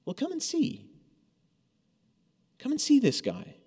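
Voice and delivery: a hint of a friend cheerfully inviting; preacher lightly voicing Philip telling Nathanael to come see; Philip's eager invitation. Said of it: "To which Philip responds".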